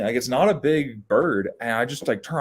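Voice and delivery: usual speaking voice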